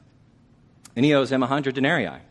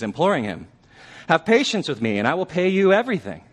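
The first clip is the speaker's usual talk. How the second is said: pleading, raised and louder; a servant's desperate plea on his knees; begging servant; 6 semitones higher, 6 dB louder than usual. Retold or imitated. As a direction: beg for patience, voice climbing.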